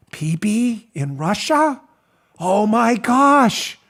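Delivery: very slow, drawn-out fake horror; mock shock. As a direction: play Rosenstein hamming up the shock. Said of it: "Here's Rosenstein".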